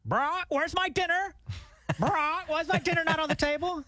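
nagging parrot. Pretend. Imagining Tig's parrot nagging Brock for dinner, high squawky parrot voice. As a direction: squawk it out like a pet bird nagging its owner. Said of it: "like, your parrot".

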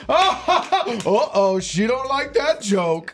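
high, laughing, then slow; mock laughing celebrity. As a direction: mimic a laughing man caught off guard. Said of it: "he was sitting there laughing, going".

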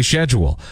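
'schedule' as SHED-yool; sh pronunciation. Shown as a demonstration. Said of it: "you better damn well say schedule".

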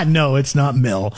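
usual speaking voice

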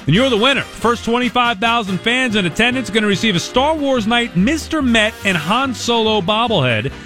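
upbeat fast promo read; radio contest promo. Acted. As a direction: pitch the ticket giveaway over music.

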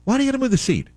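exasperated. Real, not acted. Complaint about mechanic.

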